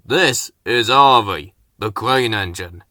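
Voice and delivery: lightly voiced, proud introduction; a hint of a proud boss presenting; proud Fat Controller. A narrator's reading. Controller proudly presents the new engine, lightly voiced. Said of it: "said the Fat Controller proudly".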